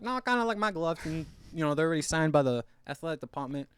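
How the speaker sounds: high, loud mock refusal; Ray Mancini. Ray Mancini declining other gloves, mock protest. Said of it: "Ray Mancini's like".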